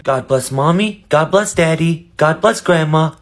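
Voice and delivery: put-on praying child. Child praying aloud at bedtime.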